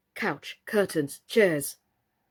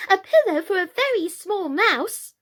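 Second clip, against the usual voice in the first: put-on much higher, louder little boy; excited; 12 semitones higher, 6 dB louder than usual. A narrator's reading. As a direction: beg eagerly like a small child. Apple still excited.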